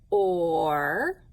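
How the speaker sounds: "Or," held long and low, with a rise at the end; drawn-out sound demo. Shown as a demonstration. Teacher leading the class in saying the sound together, drawn-out demo. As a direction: stretch "Or," into one long, loud sound. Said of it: "Let's say this one together".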